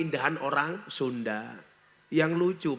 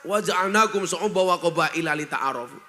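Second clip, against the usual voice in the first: Quran recitation; 4 semitones higher, 6 dB louder than usual. Acted. Preacher reciting scripture before the crowd, chanted. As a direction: intone the verse like a preacher opening.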